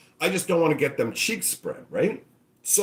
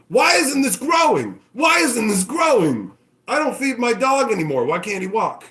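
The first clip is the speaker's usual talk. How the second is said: hammed-up bewildered shout; 7 semitones higher, 4 dB louder than usual. Clueless owner yelling at a dying plant, mock bewilderment. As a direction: yell it in dumb disbelief.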